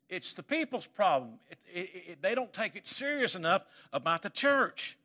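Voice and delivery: loud, high fervent preaching; pulpit-pounding sermon voice; preaching at full force. Acted. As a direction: drive it home to the congregation, rising.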